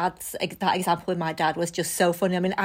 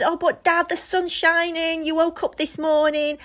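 higher, bright coaxing cheer to a gloomy dad; mock upbeat pep talk; 8 semitones higher than usual. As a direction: pour on the forced positivity, pitched up. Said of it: "giving him everything I had".

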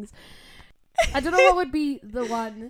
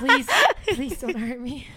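laughing mock plea; 3 dB louder than usual. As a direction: giggly fake fear. Joking plea to grumpy friend.

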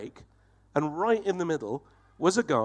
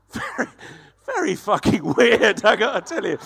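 bewildered husband; 5 semitones higher, 5 dB louder than usual. Acted. Imagined husband's reply after bizarre day.

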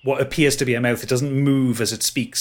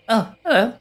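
high, bright little faun greeting; startled faun boy; 12 semitones higher, 4 dB louder than usual. Acted.

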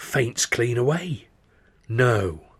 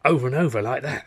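usual speaking voice

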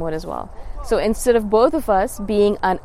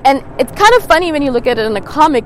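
ordinary talk, nothing marked

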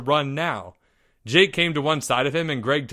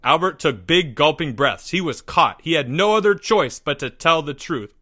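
usual speaking voice

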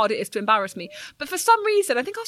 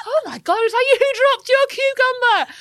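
much higher, louder mock panic; high squeal; 13 semitones higher, 6 dB louder than usual. Caught out by the cucumber runner, high squeal. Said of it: "And I was like".

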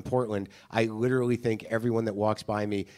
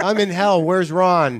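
higher, louder comic cry for help; mock frantic energy; 5 semitones higher, 6 dB louder, slower than usual. Host imitates comic lost in hell, mock frantic. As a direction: call out as if lost, hammed up. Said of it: "You have that kind of energy".

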